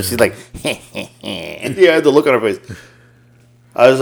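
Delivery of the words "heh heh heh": very slow, hushed sly chuckle, imitating a toddler; sinister little laugh. Grandpa retelling the toddler's trick, hushed sinister chuckle. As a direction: drag out a mock villain heh heh heh. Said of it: "But she did it sinister".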